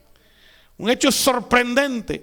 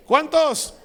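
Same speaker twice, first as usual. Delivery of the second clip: "¿Cuántos?" again, higher and louder, falling at the end; emphatic repeat; 6 semitones higher, 4 dB louder than usual. Acted. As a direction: push the repeated "¿Cuántos?" up to the whole congregation. Pastor repeats the question louder. (repetición enfática, más aguda y fuerte)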